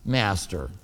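low, soft tired "Master"; a hint of a worn-out fisherman sighing; weary Peter. Peter sighing to Jesus, low and tired. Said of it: "The way I think he said it was like this".

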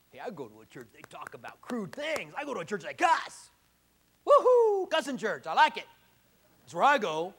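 loud, whooping party-goer voice; mock thrilled churchgoer. Preacher mocks a new churchgoer bragging, hammed-up glee. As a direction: ham up a fan of a cussing church. Acted excited cussing-church fan.

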